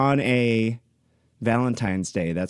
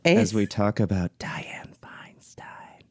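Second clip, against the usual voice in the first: slow breathy ASMR whisper; 4 semitones lower, 3 dB quieter, slower than usual. Shown as a demonstration. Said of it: "Little A S M R".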